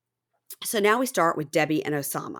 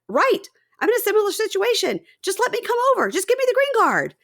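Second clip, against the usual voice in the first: high-pitched, louder mock pleading; an over-eager imitation, pitched way up; 4 dB louder than usual. Podcaster mimics Johan begging Debbie for help, eagerly pleading.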